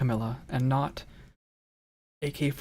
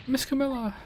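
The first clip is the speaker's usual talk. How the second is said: high little creature call; 11 semitones higher than usual. Acted. Small character calls out.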